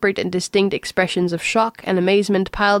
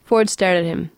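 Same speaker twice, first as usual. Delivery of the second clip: plain reading voice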